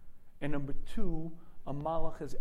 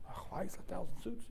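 softer, puzzled voice; bewildered old Shmuel; 2 semitones higher, 10 dB quieter than usual. Retold or imitated. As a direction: ask it baffled.

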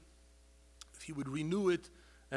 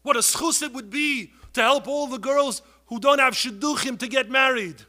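louder, higher pleading call; impassioned cry; 7 semitones higher, 11 dB louder than usual. Real, not acted. Preacher crying out for girls without shidduchim. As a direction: plead with the whole crowd.

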